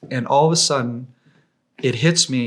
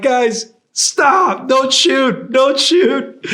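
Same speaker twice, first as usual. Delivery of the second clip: high, loud plea to hold fire; scream; 13 semitones higher, 7 dB louder, much slower than usual. Retold or imitated. Guard screams at his team not to shoot him, high and loud. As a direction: yell desperately so they don't shoot. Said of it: "I scream".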